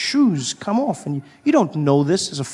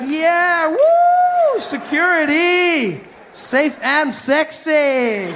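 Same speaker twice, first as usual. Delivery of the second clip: partying traveller; 9 semitones higher, much slower than usual. Pretend. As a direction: cheer like at a party. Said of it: "you'd be like".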